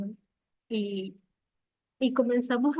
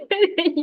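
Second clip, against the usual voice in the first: high, loud giggle; a bashful burst of laughter; giddy laugh; 9 semitones higher, 6 dB louder than usual. Real, not acted. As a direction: laugh at yourself. Laughing at her own answer.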